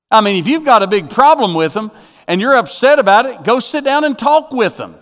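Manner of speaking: urgent, insistent sermon exhortation; forceful preaching. Real, not acted. Preacher urging believers to forgive right there, forceful. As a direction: press the congregation to act, pitch often lifted.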